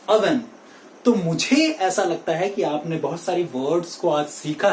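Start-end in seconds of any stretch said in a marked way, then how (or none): 0.1-0.4 "oven" said UH-ven; right oven. Shown as a demonstration. Teacher models the right pronunciation, uh onset. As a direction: start "oven" on a short uh. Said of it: "The right pronunciation for this word".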